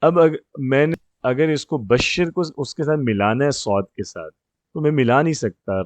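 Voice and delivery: ordinary talk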